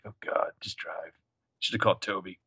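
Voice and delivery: very soft, low aside as a fed-up passenger; exasperated mutter. Acted. Caleb to the driver on a car ride, exasperated mutter. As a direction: mutter it under your breath, imitating an exasperated man.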